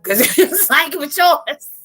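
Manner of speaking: high-pitched laughing through words; squealing laugh into the words. Real, not acted. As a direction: crack up while talking. Laughing at friend's messages.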